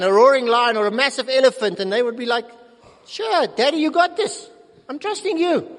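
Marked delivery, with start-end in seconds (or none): 3.1-5.7 put-on high-pitched little child to daddy; carefree small child's trusting reply; trusting toddler. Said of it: "my little sons when they were younger".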